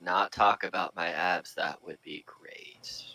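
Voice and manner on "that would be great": whispered aside, voice gone; embarrassed whisper. Acted. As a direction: drop to a breathy whisper. Asks not to discuss abs.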